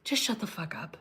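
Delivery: hushed, almost silent dismissal; whispered scorn at a liberal sister; mock whispered shut up. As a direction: breathe it out under your breath, fed up. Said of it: "I'm like".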